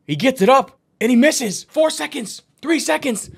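high, urgent, building to a loud shout; frantic countdown. Real, not acted. Commentator on the final seconds, frantic countdown.

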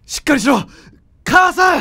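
frantic cry for mother to hold on; shouting. Acted. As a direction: yell to wake her, rising to a scream. Son shouts at collapsing mother.

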